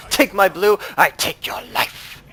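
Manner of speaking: loud, slow hammy villain threat; villain taunt. Acted. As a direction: gloat like a cartoon villain. Taunting enemy over buff.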